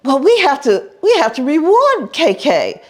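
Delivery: high lady voice; sweet-talking society lady; imitating Madame. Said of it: "she said".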